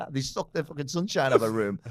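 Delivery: laughing loudly and high through the words; spluttering it out mid-laugh; a loud laugh breaking into the line. Real, not acted.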